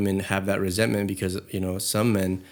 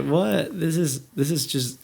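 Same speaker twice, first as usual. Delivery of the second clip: higher-pitched, incredulous what; mock disbelief; 7 semitones higher, slower than usual. Imagining her reaction to someone else paying, mock disbelief. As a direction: act out being taken aback.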